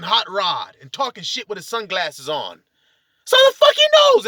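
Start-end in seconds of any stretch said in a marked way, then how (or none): 3.2-4.3 high, loud burst of contempt; scornful outburst. Real, not acted. Ranting about who defines a man, scornful shout. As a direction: yell it in heated scorn.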